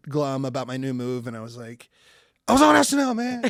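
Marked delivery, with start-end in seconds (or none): 2.5-3.4 loud, high-pitched mock protest; comic indignant comeback; defensive outburst. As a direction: blurt it out, wounded and defensive. Defensive comeback in a retold ride.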